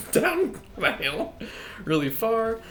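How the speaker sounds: high, loud and stretched out slow; gleeful. Real, not acted. Gamer relishing launching the shaman, high and gleeful. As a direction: savor sending him flying. Sending shaman flying, laughing.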